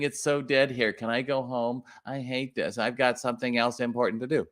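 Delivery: lightly voiced whiny clerk, sagging off; a hint of a complaining employee, fading away; whiny bored employee. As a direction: mimic a bored worker who wants to go home. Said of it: "the ones saying".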